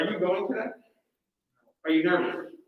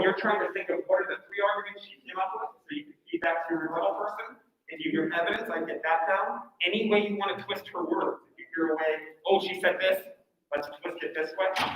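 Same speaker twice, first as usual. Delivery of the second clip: muffled behind a mask, a lot softer; teacher's voice dulled by a cloth mask; speaking through a face mask; 9 dB quieter than usual. Shown as a demonstration. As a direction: talk through the mask at normal effort. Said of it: "can you tell a big difference with my mask is on and off?".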